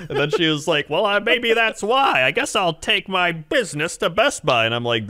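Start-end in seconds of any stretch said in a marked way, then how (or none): 0.9-4.7 put-on old woman. Old woman taking her business elsewhere, huffy mock voice. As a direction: draw it out like an affronted customer. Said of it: "this old woman with Kung Fu Panda".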